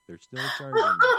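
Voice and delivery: high, wailing laughing complaint; mock tearful plea. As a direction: wail it to the judge, hammed-up.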